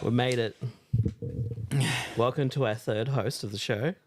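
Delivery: triumphant shout; loud. Real, not acted. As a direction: belt it out in triumph. Toy finally stuffed, triumphant.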